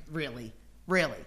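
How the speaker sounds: scoffing, disbelieving really; derisive really. Shown as a demonstration. Preacher shows the scornful laugh in words, mocking. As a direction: sneer it in disbelief. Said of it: "That's the laughter".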